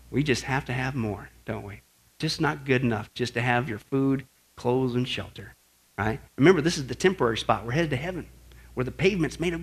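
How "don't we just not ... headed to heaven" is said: usual speaking voice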